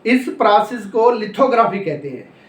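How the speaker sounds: ordinary delivery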